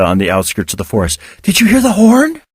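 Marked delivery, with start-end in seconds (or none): 1.4-2.4 excited. A narrator's reading. Excited question.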